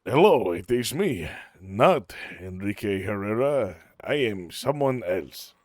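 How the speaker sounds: exaggerated imitation of a Spanish accent, swooping pitch; guy faking an accent. Shown as a demonstration. Pretending to be that guy posing as someone else, overdone accent. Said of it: "trying to be that guy doing an accent".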